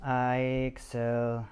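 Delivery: very slow, halting sounding-out; struggling reader. Shown as a demonstration. Dyslexic narrator showing how he reads, struggling and slow. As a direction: labour over "Ixel dis", drawing out each syllable. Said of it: "I have always struggled with reading".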